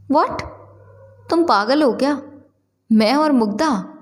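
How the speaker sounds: high-pitched, disbelieving outburst that settles; taken aback, are you serious; incredulous. A narrator's reading. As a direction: scoff at an absurd suggestion. Mihir denies the suggested romance.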